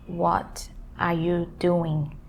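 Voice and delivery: slow, each word of "What are you doing?" separate; careful, separated words in "What are you doing?". Shown as a demonstration. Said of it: "Like, word by word".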